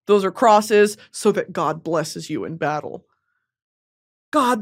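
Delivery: usual speaking voice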